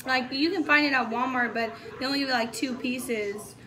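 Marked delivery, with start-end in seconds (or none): none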